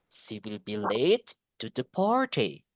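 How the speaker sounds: slow, with long "late" and stressed first syllable of "party"; stressed content words. Shown as a demonstration. Teacher modelling sentence stress, slow stretched content words. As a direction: lean on "late" and "party", link the rest. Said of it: "Penekannya di party".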